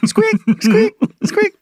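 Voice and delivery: high squeaky squeak sounds; squeaky unicycle noise. Pretend. Mimicking the unicycle passing by, high and squeaky. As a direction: squeak it out like an old unicycle wheel.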